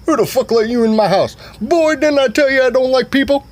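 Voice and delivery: loud, high-pitched scolding tirade; angry parent rant. Retold or imitated. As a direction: tear into him at full volume. Acted rant at the son.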